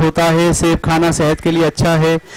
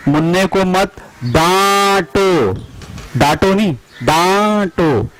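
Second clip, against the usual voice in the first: slow drill reading. Shown as a demonstration. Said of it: "reading drill sentence slowly".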